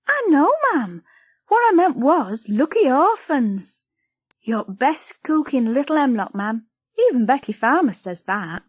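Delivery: put-on a little girl pleading politely; young Lizzie.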